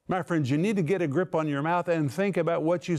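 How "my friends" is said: ordinary delivery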